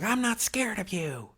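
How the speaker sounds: high-pitched mimicry of a kid; little kid. Little kid sizing up a man in line, high mocking imitation. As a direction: imitate a defiant child in a high voice. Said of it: "there was this little kid".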